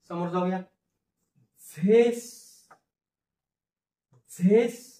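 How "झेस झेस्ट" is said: झेस held long, then zest with ट detached; slow teaching sound-out of zest; broken-up slow pronunciation. Shown as a demonstration. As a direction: stretch zest slowly in parts. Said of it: "फोडून फोडून उच्चार लिहितो आणि हळूहळू".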